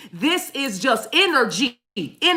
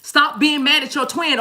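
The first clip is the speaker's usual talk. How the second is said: usual speaking voice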